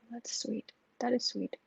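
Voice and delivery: breathy hushed "that's sweet"; soft whisper. Real, not acted. Host touched by a viewer's charity tip, whispering.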